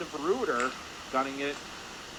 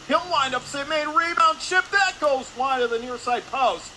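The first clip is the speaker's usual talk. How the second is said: loud, high call of a scoring chance; excited play-by-play; 10 semitones higher, 6 dB louder than usual. Real, not acted. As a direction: jump up sharply as the shot comes. Calling shot, save and rebound.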